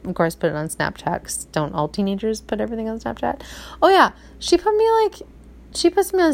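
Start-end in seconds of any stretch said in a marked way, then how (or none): 3.8-6.3 exclaiming. Real, not acted. Teen posts her on Snapchat.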